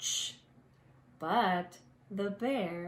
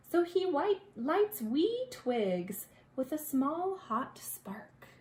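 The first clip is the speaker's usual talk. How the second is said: plain reading voice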